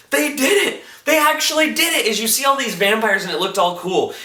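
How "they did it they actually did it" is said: high-pitched excited disbelief; amazed retold cheer. Onlooker seeing all the lights turn on, amazed. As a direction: imitate astonished joy, voice lifted high. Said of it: "Dagny Taggart's like".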